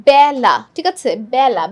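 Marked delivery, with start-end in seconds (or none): 0.1-0.5 বেলা with open "æ", as bæla, drawn out and louder; open vowel. Shown as a demonstration. Teacher demonstrates how it is pronounced, open vowel. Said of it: "উচ্চারণটা দেখো কি হয়". 1.3-1.6 বেলা with open "æ", as bæla; open vowel. Shown as a demonstration. Teacher repeats the pronunciation, open vowel. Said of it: "বেলা না বলে আমরা কি বলি".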